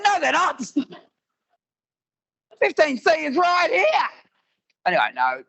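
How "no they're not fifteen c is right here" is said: mock indignant shout. Arguing his seat is empty.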